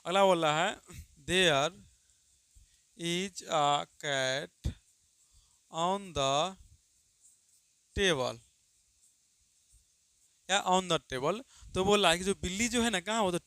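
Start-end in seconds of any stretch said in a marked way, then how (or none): 1.3-8.4 word by word, with long gaps; slow dictation. Shown as a demonstration. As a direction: read it out so students can write. Dictates a sentence slowly. (धीमे-धीमे बोलकर लिखवाना)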